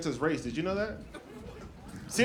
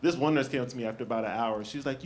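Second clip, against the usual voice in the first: ordinary delivery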